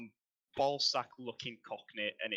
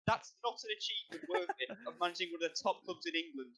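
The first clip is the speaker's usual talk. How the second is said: much higher, louder furious rant; angry rant; 7 semitones higher, 13 dB louder than usual. Real, not acted. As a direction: tear into him with your voice raised high. Ranting straight at Lampard.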